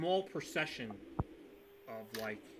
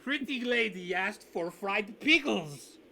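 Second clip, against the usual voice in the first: slow, higher put-on voice of a jolly host; NPC host voice; 7 semitones higher, 8 dB louder, slower than usual. GM voicing the innkeeper to the party, slow and higher. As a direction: croon it like a grinning host flattering a guest.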